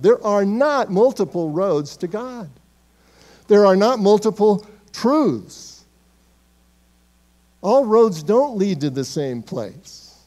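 slow, stressed pulpit declaration; preacher insisting on the one truth; emphatic preaching. Acted. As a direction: lean on every word like a verdict.

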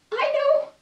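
high piping little monkey voice; clever little monkey. Acted. The little monkey with a plan, high piping voice. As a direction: squeak it up high with an idea. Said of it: "says one little monkey".

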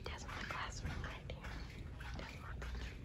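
soft whisper while walking past. Real, not acted. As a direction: keep it hushed near the class. Passing by classroom, hushed.

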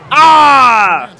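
sharp loud cry of dismay at the screen; loud pained gaming yell; frustrated shout. Real, not acted. Frustrated yell at missed play.

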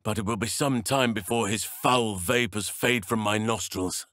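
usual speaking voice

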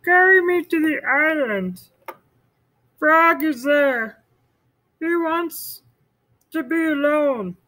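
high, slow Toad voice; Toad asking. Acted. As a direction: ask the turtle for help, high. Toad asks turtle.

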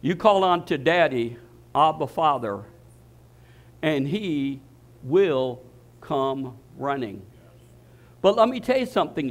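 ordinary talk, nothing marked

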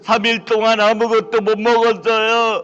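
slow, drawn-out hungry moans; starving beggar moaning. Acted. Beggar moaning at the rich man's gate. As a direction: moan like a beggar faint with hunger.

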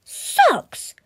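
high, loud howl of "Socks!"; a spoiled boy howling in disgust; howling brat. Acted. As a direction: tantrum shriek of a greedy child. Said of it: "howled Bradley".

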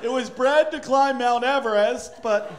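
slow, loud, self-important brag; pompous American dog owner. Retold or imitated. To another owner in the park, a slow proud brag. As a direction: show off a rescue like a trophy. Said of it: "people in America are like".

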